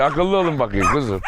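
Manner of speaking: normal talking voice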